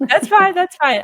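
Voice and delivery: high, loud laughing "that's fine"; laughing reassurance. Real, not acted. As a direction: brush it off with a laugh. Laughing off the accent mixup.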